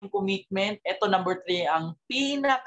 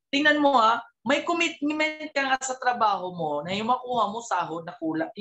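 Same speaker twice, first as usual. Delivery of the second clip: usual speaking voice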